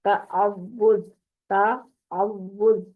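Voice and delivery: تعوذ stretched slowly with the و doubled and held; doubled waw. Shown as a demonstration. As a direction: lean on the shadda on و in تعوذ. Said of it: "واو کے اوپر دیکھیں تشدید لگی".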